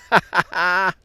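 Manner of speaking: high, loud chuckling laugh; delighted laugh. Real, not acted. Delighted after hooking fish.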